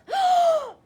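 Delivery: loud high rushing breath of fire; fiery whoosh. Acted. Dragon blowing a huge whoosh of fire.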